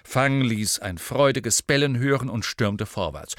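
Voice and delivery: usual speaking voice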